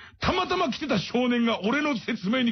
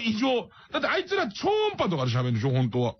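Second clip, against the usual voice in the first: normal talking voice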